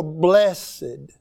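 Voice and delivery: slow "blessed" as BLESS-ed; two-syllable blessed. Shown as a demonstration. Preacher quoting the verse his way, "blessed" said as BLESS-ed. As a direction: draw out "blessed" with the ED as its own syllable. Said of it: "if I can say it that way".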